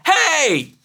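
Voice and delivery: high, loud imitated yell of a child; child's shout. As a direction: shout it like an upset six-year-old. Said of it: "And he shouted again".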